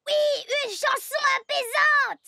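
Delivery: loud, eager, high-pitched; too loud. Acted. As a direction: blurt it out far too loudly. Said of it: "tu parles trop fort".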